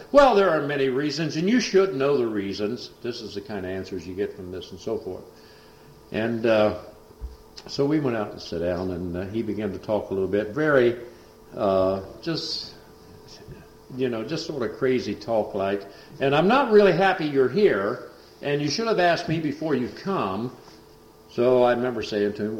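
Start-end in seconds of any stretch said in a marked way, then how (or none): 0.1-2.9 higher, louder defiant retort; retold scoff. Son scoffing at the preacher, higher and louder. 16.2-20.5 higher, louder resentful complaint; imitating crazy talk. Son resenting the preacher's visit, higher and louder.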